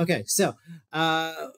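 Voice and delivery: ordinary talk, nothing marked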